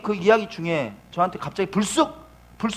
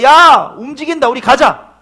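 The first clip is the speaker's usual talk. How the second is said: excited shout; 10 semitones higher, 15 dB louder than usual. Acted.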